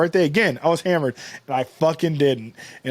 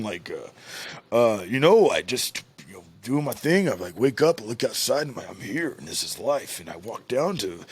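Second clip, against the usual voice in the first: laid-back uncle voice; 6 semitones lower, 5 dB quieter than usual. Retold or imitated. Uncle's words voiced in story.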